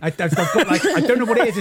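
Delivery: giggling through words; laughing while speaking. Real, not acted. Laughing at own reluctance.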